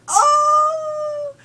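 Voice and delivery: very high, loud startled "Oh!"; sudden shrill-free high cry of shock; shocked yelp. Pretend. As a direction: yelp it out like a jolt of pain. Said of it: "and I was like".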